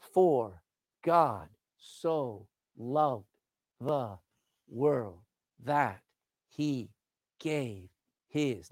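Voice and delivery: very slow, low, each word separated; one word at a time with a pause after each; measured, isolated monosyllables. Shown as a demonstration. Showing the verse's one-syllable words.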